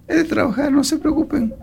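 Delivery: high, soft carefree voice of the idle bee; lazy bee. Acted. Lazy bee answers her sisters. (abeja perezosa, voz aguda y suave, despreocupada)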